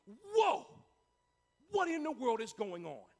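startled king, loud high burst on Whoa; amazed. Acted. King leaping to his feet at the furnace, loudly amazed. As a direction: burst out in astonishment, then puzzle. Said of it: "leaped to his feet in amazement".

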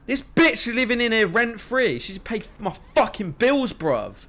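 loud, high-pitched indignant rant; getting pissed off. Real, not acted. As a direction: rant in real exasperation. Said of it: "I'm getting pissed now".

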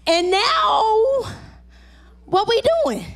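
high, crying out; anguished plea. Acted. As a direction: cry it out in frustration. Pleading disciples to Jesus.

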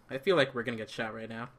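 ordinary talk

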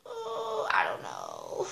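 mock tearful cluelessness; helpless whine. Daughter answering her scolding mom, helplessly whining.

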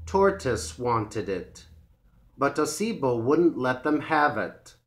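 ordinary delivery

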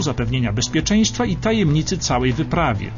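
normal talking voice

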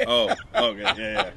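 ordinary delivery

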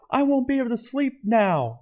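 yelling. A narrator's reading. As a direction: shriek it out in fright. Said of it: "yelled B-Dubs".

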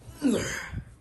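a high sigh before heading off; nervous sigh. Acted. Before checking the noise, a high nervous sigh. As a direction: breathe out the nerves, then go.

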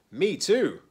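high, loud and keen; eager. A narrator's reading. Piggy wants to read the book too, eagerly.

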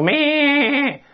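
long high imitated baa; sheep bleat. Shown as a demonstration. Teacher demonstrates a sheep's cry for students, drawn-out bleat. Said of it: "下面这是羊叫".

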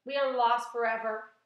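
loud, despairing cry; loud, doom-laden declaration; shouting. A narrator's reading. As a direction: call out that all is lost. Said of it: "shouted the ladybug".

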